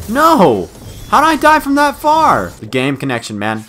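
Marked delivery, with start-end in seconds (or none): 0.1-2.5 high-pitched loud yell of disbelief; outraged protest. Real, not acted. Player protesting a death from that far, outraged yell. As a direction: cry out at the unfairness.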